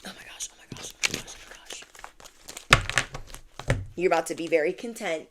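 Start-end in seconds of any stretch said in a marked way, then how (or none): 0.1-3.8 breathless whispered repeats, slowing; awed whisper. Real, not acted. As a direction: whisper to yourself in awe. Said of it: "let me calm down".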